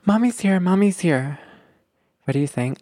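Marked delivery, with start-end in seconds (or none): 0.1-1.4 higher, sing-song mommy voice; soothing mom. Acted. Mom comforting her crying baby, soothing. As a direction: coo it to reassure a baby. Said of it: "What does, like, a mom say?".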